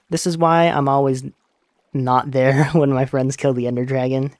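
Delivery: usual speaking voice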